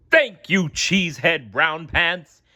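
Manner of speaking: high, slow pompous official; grandly thanking the hero; mayor voice. A narrator's reading. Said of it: "the mayor said to SpongeBob".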